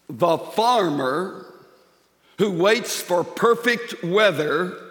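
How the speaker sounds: slow, weighty Bible reading; solemn scripture reading. Acted. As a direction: read each phrase slowly with long pauses. Reads scripture verse slowly.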